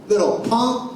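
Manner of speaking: imitated street heckle; retold taunting yell. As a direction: toss out a bullying insult, half-yelled. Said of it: "they're yelling at me not to cry".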